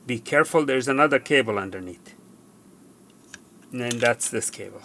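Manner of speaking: ordinary delivery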